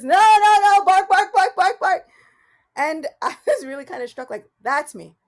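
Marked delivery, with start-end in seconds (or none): 0.0-2.1 loud, high voiced barks; frantic puppy barking. Puppy stuck in the cage again, loud high barking. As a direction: bark like a stuck puppy in a panic. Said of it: "the puppy shoved its head back through the bars".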